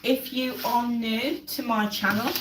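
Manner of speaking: ordinary talk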